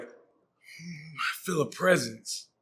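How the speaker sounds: hushed eerie psychic; breathy hum of a medium; mystic psychic voice. Retold or imitated.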